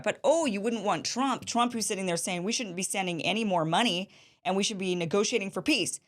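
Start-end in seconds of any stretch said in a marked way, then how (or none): none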